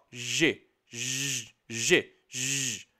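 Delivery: "G" e "J" ditos baixo e devagar, só o som; os dois sons do G. Shown as a demonstration. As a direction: pronuncie "G, J" como som de letra, baixo. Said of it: "ó". (sons da letra: "G" como gê, "J" como jota)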